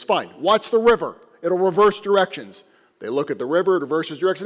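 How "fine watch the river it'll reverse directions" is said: irritated rabbi. Acted. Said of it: "He goes".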